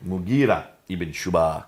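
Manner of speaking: Arabic name in an American accent. Real, not acted. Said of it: "That's my American accent".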